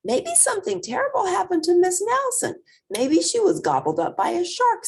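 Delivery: put-on high, fast, loud child voice; excited little kid, squeaky and quick; alarmed kid. A narrator's reading. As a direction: blurt out the wild guess, high and hurried. Kids guess in alarm.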